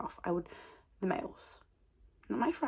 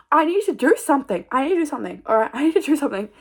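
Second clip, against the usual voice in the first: higher, louder, urgent; a loud, higher "I need to do something"; exasperated outburst; 6 semitones higher, 10 dB louder than usual. Real, not acted. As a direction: shout at yourself to act. Frustrated self-exhortation.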